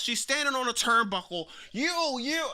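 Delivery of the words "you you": high, drawn-out mocking quote; mocking imitation. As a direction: mimic her with long high vowels. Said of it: "right here saying".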